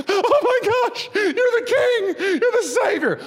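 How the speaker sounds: very high, drawn-out squeal of awe; hammed-up amazement. Preacher hamming up Nathanael recognizing the Savior, squealing amazement. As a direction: overact a slow, squealing revelation with laughter.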